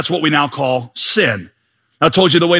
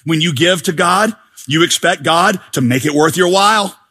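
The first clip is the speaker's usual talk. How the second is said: ordinary talk, nothing marked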